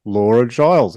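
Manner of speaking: ordinary talk, nothing marked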